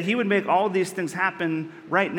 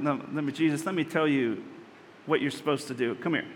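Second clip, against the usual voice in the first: low, softened aside to Jesus; imagined Peter's rebuke; 4 semitones lower, 5 dB quieter than usual. Acted. Peter takes Jesus aside to rebuke him, low and softened. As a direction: take him aside and quietly correct him. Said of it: "Imagine being Peter in that moment".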